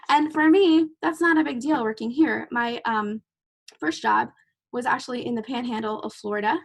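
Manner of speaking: ordinary talk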